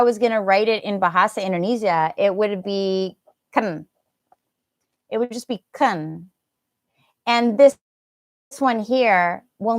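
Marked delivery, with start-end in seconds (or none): 3.5-3.9 "ken", a reduced "can" with a weak short vowel; shortened can. Shown as a demonstration. Teacher showing how can sounds in sentences, reduced vowel. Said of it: "when it's in a sentence". 5.7-6.3 "ken", a reduced "can" with a weak short vowel; shortened can. Shown as a demonstration. Teacher showing how can sounds in sentences, reduced vowel. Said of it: "we shorten it when you're saying it".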